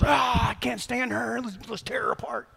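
lampoon the offended churchgoers; mock outrage. Preacher mimicking Christians angry at an athlete, mock outrage.

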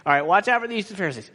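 rattled off fast, tossed away; rushed retelling. Retold or imitated. Preacher hurrying through Jesus' warning, fast. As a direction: zip through it like an afterthought.

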